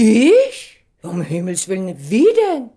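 high, loud outraged "Ich?"; indignant disbelief. Acted. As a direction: fire back, shocked and offended. Indignant outburst at accusation.

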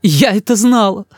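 high, wounded cry; high-pitched aggrieved outburst; hurt mother. A narrator's reading. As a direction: reproach him, bitterly hurt. Mother near tears in quarrel.

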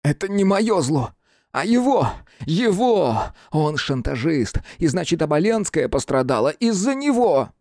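high-pitched, raging accusation; furious outburst. A narrator's reading. Hunchback blaming the blackmailer, furious. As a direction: hurl the blame at someone else. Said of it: "яростно заговорил".

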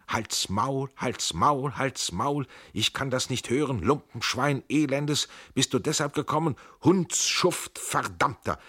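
high, loud raging screams, foaming at the mouth; furious screaming. Acted. The Pandur screams at Tränk in blind fury. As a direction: spit every insult out at full volume, beside yourself with rage. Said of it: "und er schrie".

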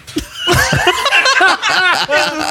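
long, high burst of laughter; laughing. Real, not acted. Friend cracking up at the shower talk, high laughter. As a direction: crack up helplessly, high-pitched.

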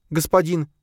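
put-on high, small frightened girl; timid girl voice. A narrator's reading. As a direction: voice her high and unsure. Frightened girl asks master.

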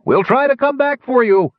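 high, loud gnome call. A narrator's reading. Gnome calling to Sturm as ship leaves, high loud. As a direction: promise it over the storm, high. Gnome shouting through storm.

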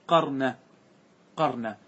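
"قرن" said "QAR-na", a after the ق; fatha on the qaf. Shown as a demonstration. Teacher giving the resulting word, "قرن" as qar-na. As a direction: say each "قرن" as qar-na, short a. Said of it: "بفتح القاف".

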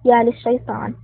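ordinary delivery, nothing marked

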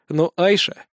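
ordinary delivery, nothing marked

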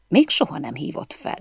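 ordinary delivery

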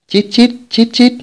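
high-pitched imitated squeaking; narrator's high, repeated rodent chitter; piercing rat squeaks. A narrator's reading. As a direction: squeak like a swarm of rats. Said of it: "lanh lảnh chói tai".

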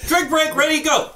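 high-pitched, laughing countdown call; playful rallying call. Pretend. Calling a drinking-game break to group.

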